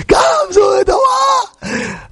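drawn-out, very high screamed chant; child's scream. Retold or imitated. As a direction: scream it slowly like a nine-year-old. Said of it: "Scream it".

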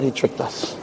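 breathless whisper of realisation; stunned whisper. Retold or imitated. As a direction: whisper it in shock. Jury realises they were fooled.

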